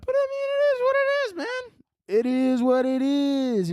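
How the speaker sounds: very high-pitched shrug-off; high silly voice. Pretend. Brushing off feeling like a dick, high silly voice. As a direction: squeal it, then stretch it out.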